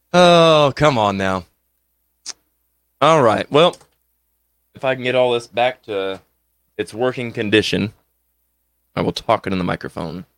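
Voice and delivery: ordinary talk, nothing marked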